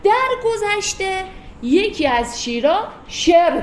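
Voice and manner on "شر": "شر" said as "sher", with an e vowel; old majhul pronunciation. Shown as a demonstration. Teacher showing how one شیر was said formerly, "شر" as "sher". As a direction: use e, not ee, in "شر".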